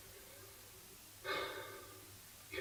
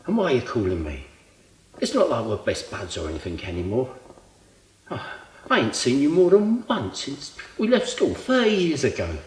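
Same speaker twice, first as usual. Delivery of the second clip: louder, indignant rant; exasperated protest; 3 semitones higher, 7 dB louder than usual. Acted. Old friend asking why he was called at all, exasperated. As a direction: push back hard at an unwanted demand.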